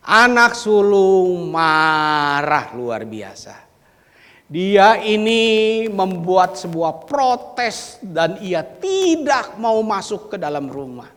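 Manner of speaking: loud, slow, high-pitched preaching; forceful preaching. Acted. Preacher booming the elder son's anger at the congregation.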